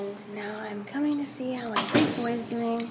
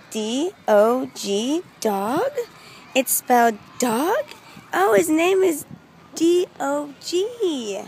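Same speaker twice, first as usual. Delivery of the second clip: slow, puzzled spelling, rising to higher realization; mock dawning surprise; 5 semitones higher, 7 dB louder, slower than usual. Child realizing the dog's name is spelled dog, mock surprise. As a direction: work it out slowly, then the penny drops. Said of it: "I'm like".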